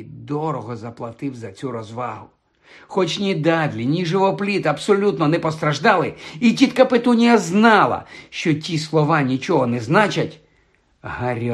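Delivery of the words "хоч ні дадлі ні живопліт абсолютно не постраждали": ordinary delivery, nothing marked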